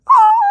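loud, very high scream; shrieked yelp. Real, not acted. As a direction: shriek in mock horror. Recoils from a disliked image.

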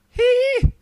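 high [name]-style hee; loud piercing falsetto whoop; falsetto squeal. Acted. Dancing montage gag.

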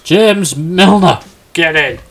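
slow, high, loud cry of the name; drawn-out gleeful yell; triumphant shout. Real, not acted. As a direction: celebrate the punchline. Delighted at surprise answer.

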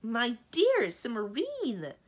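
slow, loud, shocked protest; drawn out in loud shock. Acted.

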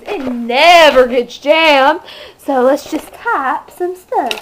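1 mock sales pitch. Pitching a computer like an ad.

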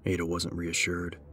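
usual speaking voice

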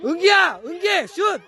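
yelling from the sidelines. Real, not acted. Urging a child to shoot.

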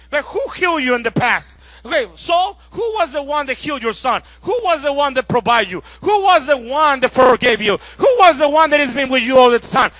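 loud, forceful preacher's challenge; commanding the soul. Acted. Preacher confronting own soul, loud insistent questioning. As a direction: drive each question home at full volume. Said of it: "I speak to my soul".

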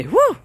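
half-hearted singer's holler; mock gospel scream. Gospel singer screaming in the song, mock whoo. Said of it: "they might scream and say".

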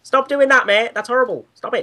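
high, loud British scolding; hammed-up British protest; mock British accent. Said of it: "British sheriff".

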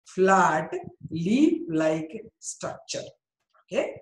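usual speaking voice

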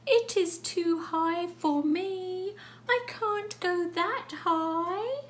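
high, drawn-out worried wail; worried cat. Acted. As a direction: play a hammed-up anxious cat, loud and slow. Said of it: "It's a bit worried".

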